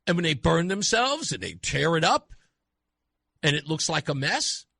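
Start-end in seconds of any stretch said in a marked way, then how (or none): none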